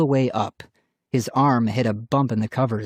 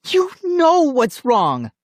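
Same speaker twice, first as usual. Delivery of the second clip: high, loud, drawn-out yell; angry accusation; 14 semitones higher, 5 dB louder, slower than usual. A narrator's reading. Sister accusing brother over her doll, angry yell.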